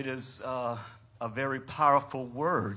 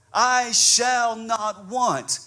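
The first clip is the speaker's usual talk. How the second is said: louder, higher and slower, building to a cry; preaching at full force; 5 semitones higher, 5 dB louder, slower than usual. Acted. Pastor preaching Psalm 23 to the congregation, full force. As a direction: preach it out with mounting fire.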